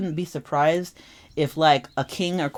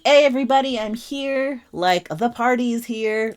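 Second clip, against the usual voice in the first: higher and louder, show-off announcement; flashy loud entrance; 7 semitones higher, 5 dB louder than usual. Retold or imitated. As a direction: burst into the room wanting all eyes.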